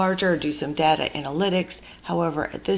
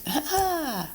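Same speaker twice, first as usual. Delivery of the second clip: short high laugh; 11 semitones higher than usual. Real, not acted. Oops while scrolling.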